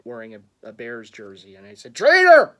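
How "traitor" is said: high, very loud shout; accusing shout. Retold or imitated. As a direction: shout it out at a rival fan. Jokingly calling out a rival fan.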